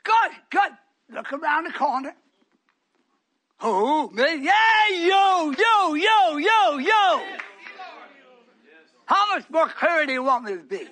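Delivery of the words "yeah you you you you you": pointing shout of you, you. Real, not acted. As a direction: jab each you at him. Preacher singling out a listener.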